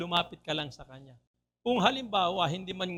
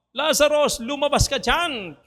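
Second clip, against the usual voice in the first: high, loud command to come out; Jesus shouting at the tomb to raise Lazarus; 7 semitones higher, 5 dB louder than usual. Retold or imitated. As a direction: bellow it toward the tomb. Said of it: "at sumigaw".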